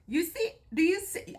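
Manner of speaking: eager teasing. Real, not acted. Wife showing off her muscle to her husband, playfully insistent. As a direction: coax him to look, delighted.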